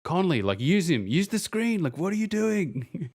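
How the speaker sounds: rising, pleading disbelief at the players; exasperated fan yelling. Retold or imitated. Frustrated fan shouting at his team on TV, exasperated. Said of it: "I'm just no longer going".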